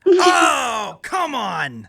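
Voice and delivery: sudden high yelp of protest, then it drops; outraged protest. Real, not acted. Co-host protests the worst-movie claim, outraged yelp. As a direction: cry out in mock outrage at the claim. Disagreeing with a hot take.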